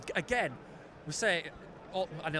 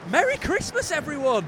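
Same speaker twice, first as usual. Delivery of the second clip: festive greeting; 11 semitones higher, 9 dB louder than usual. Real, not acted. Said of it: "I tell you what we should say".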